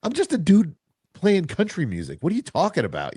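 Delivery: shoots way up in pitch, incredulous; mock disbelief. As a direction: act baffled that anyone knows you. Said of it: "I'm like".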